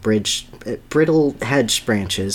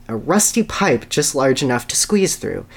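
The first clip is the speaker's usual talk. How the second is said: ordinary talk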